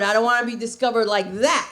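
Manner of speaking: usual speaking voice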